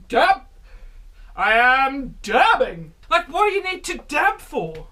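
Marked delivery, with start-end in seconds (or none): 0.1-0.4 short shouted command; loud call. Real, not acted. Calling out the dance move. 1.3-2.9 slow, loud put-on voice; silly slow voice. Announces dabbing, slow odd voice.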